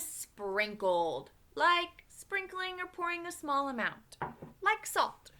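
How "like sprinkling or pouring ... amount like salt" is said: plain reading voice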